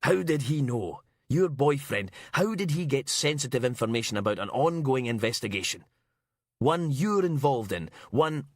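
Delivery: acted inspector's indignant rant; angry interrogation. A narrator's reading. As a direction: demand answers in a temper. Inspector rages, slamming fist on desk.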